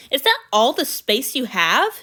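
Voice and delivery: loud, slow, indignant question; demanding. A narrator's reading. God sees the cramped closet, loud and indignant. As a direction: demand it in disbelief. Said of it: "he demanded".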